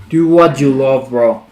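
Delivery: ordinary talk, nothing marked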